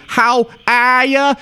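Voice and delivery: drawn-out, high New England hello; New England greeting. Acted. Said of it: "everybody from New England".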